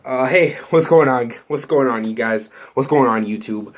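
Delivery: ordinary talk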